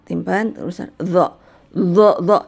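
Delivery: steady buzzing zo without lifting the tongue; zo buzzed with the tongue kept on the upper teeth; held zo drill. Shown as a demonstration. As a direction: keep the tongue in place and buzz zo, zo, zo. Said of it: "ujung lidahnya ditaruh di gigi seri atas".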